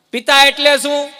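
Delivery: high, loud and slow; quizmaster question. Acted. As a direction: draw out the question grandly for the crowd. (ધીમેથી, ઊંચા અને મોટા અવાજે સવાલ)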